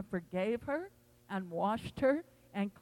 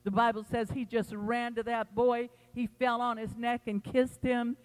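ordinary talk

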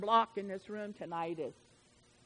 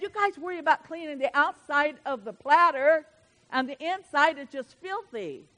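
forceful rebuke; 4 semitones higher, 9 dB louder than usual. Acted. Preacher voicing Jesus rebuking leaders.

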